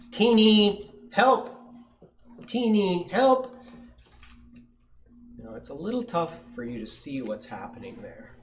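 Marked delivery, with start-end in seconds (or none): 0.1-3.6 high, loud storybook cry; calling for help. A narrator's reading. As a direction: call out urgently to Teeny. Trapped mother calls for help.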